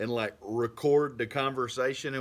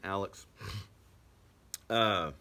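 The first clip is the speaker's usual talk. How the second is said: ordinary talk, nothing marked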